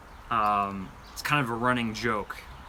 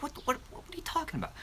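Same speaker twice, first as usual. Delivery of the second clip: much higher, fast and soft, confused; baffled viewer; 7 dB quieter, much faster than usual. Pretend. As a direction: squeak out total bafflement. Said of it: "You're gonna be like".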